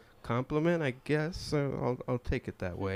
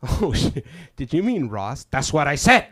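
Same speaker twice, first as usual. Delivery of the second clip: louder sharp retort; mock loud exchange; 4 semitones higher, 11 dB louder than usual. Passenger snapping that she said Ross, louder. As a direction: snap it back loudly.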